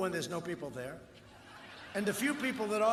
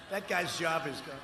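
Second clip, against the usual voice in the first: ordinary talk, nothing marked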